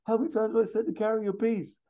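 high-pitched indignant shouting; acted shouted telling-off, rushing at the end; Axel Foley. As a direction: scold in an incredulous squawk, far above your own pitch. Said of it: "Axel just shouts at him".

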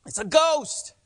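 high, loud, slow terrified shout; frightened cry. Acted. Disciples crying out in fear at the figure on the water.

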